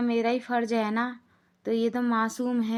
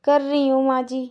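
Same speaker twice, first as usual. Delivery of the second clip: loud plea; 3 semitones higher, 7 dB louder than usual. Acted. Lajo pleads again.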